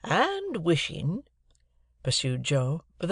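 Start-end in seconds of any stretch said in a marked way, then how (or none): 0.0-1.2 slow, higher, stretched out; effortful remembering. A narrator's reading. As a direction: stall while recalling the message. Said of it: "like another effort of remembrance".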